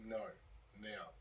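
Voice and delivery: short, restrained refusal and order; quietly firm retold command; stern inner voice. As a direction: insist softly, leaving a gap before the last word. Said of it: "a pretty stern voice inside of his head".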